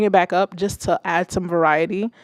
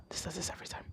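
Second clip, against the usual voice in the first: annoyed mutter; 20 dB quieter, much faster than usual. Real, not acted. Software misbehaving again.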